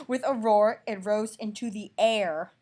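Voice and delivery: loud, slow, booming reread; loud slow mock-dramatic reread; dramatic roar. Shown as a demonstration. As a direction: roar it out big. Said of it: "To the ore".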